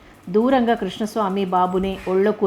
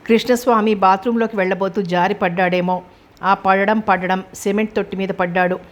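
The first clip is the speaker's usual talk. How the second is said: plain reading voice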